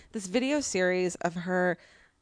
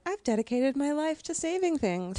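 imitating her; 6 semitones higher than usual. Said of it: "person who's like".

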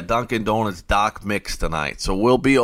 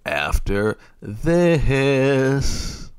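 very slow, stretched-out sign-off, pitched up; hugely elongated, higher-pitched throw to commercial; drawn-out radio tease; 4 semitones higher, much slower than usual. Real, not acted. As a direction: stretch the words out long into the break. Radio host teasing the commercial break.

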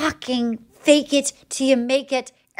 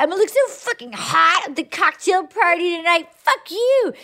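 mock defiant rant; 6 semitones higher than usual. Defiant woman after the rejection.

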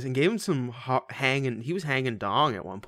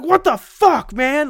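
high, loud mock outrage; mock angry outburst; 12 semitones higher, 10 dB louder, slower than usual. Imagining being woken by a prank, high loud mock outrage. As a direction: blow up in pretend fury. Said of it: "I would be so angry".

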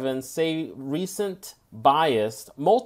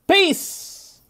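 upbeat sign-off; 14 semitones higher than usual. Acted. Host sign-off, far high.

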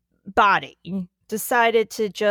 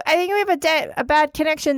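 ordinary talk

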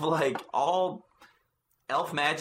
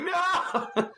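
high, laughing shocked cry. Retold or imitated. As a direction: yelp it in mock dismay. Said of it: "I was like".

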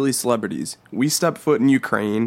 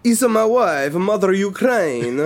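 put-on Ukrainian accent; 6 semitones higher than usual. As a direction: ham up a proud Ukrainian. Joking Ukrainian voices.

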